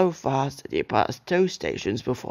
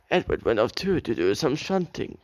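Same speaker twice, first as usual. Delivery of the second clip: ordinary delivery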